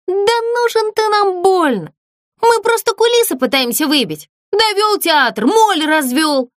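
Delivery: high, careless brush-off; dismissive. A narrator's reading. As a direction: shrug him off offhandedly. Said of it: "небрежно сказала Злюня". (небрежно, свысока)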